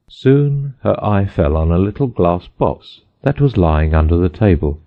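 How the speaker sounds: usual speaking voice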